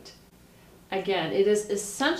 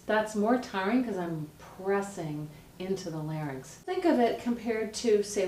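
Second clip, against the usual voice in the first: ordinary talk, nothing marked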